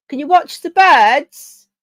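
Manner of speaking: loud, high, drawn-out call breaking off from normal talk; sudden outburst. Real, not acted. Interrupting own election talk to call to Al, sudden loud outburst. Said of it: "I'm doing the Tourette thing again".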